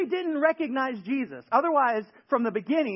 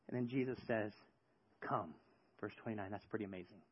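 much softer and lower; soft awe; 11 semitones lower, 15 dB quieter, faster than usual. Real, not acted. Preacher to congregation, soft and awed. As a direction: let it fall to a near hush.

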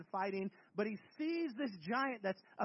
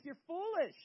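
ordinary talk

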